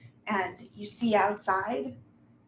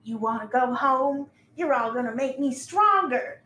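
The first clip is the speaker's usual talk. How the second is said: loud, raised villain taunt; mock their plea, then threaten at full voice; threatening creature; 4 semitones higher, 8 dB louder than usual. Monster mocks the fleeing players, loud rising threat.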